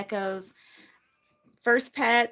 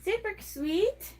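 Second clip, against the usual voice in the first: cute gecko voice; 7 semitones higher, 4 dB quieter, slower than usual. Pretend.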